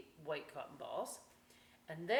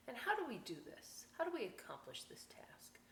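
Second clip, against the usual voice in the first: playful whisper; 6 semitones higher, 7 dB quieter than usual. Acted. Mock mysterious question to viewers.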